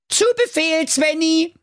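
high squeaky dwarf shout of obedience; dwarfs in chorus. Acted. Dwarfs answer their commander, squeaky and eager. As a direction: snap to attention like tiny soldiers. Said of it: "riefen die Zwerge im Chor".

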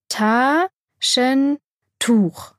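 "Taschentuch" very slow, three drawn-out syllables; teaching pronunciation. A narrator's reading. Maya has the boy repeat, "Taschentuch" syllable by slow syllable. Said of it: "sprich mir nach".

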